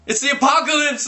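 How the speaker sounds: very high, loud cry. Acted. Liv seeing the eyes on fire, crying out. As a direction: cry it out in panic. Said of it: "Liv cried".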